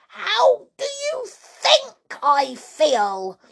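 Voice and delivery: outraged high-pitched wailing rant; furious cry. A narrator's reading. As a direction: rage at them, stretching the words. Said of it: "she cried".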